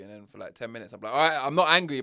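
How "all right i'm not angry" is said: loud, higher exasperated shout of not angry; shouted denial. Retold or imitated. Partner keeps calling him angry, he yells back in frustration. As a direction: yell it in frustration at the accusing partner.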